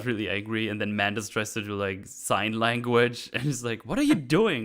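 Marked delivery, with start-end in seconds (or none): 3.8-4.6 high, louder mock annoyance; mock irritation. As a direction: snap at her in jest. Retold irritated outburst.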